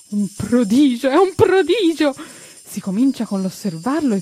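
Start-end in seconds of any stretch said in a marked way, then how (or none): 0.1-1.1 meraviglia. Acted. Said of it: "dice Mustacchio". 1.1-2.1 "prodigio" gridato molto più in alto e più forte; meraviglia crescente. Acted. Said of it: "dice Mustacchio".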